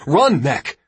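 urgent, high warning shout; loud, alarmed cry. A narrator's reading. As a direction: yell for a brother to flee. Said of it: "he shouted".

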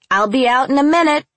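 high, loud shout to the next room. A narrator's reading. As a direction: shout it through the house. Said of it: "I shouted".

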